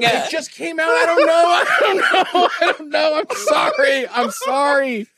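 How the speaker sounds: laughing, mortified apology. Real, not acted. Co-host apologises for an accent attempt just played back, laughing and mortified. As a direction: blurt excuses while cracking up.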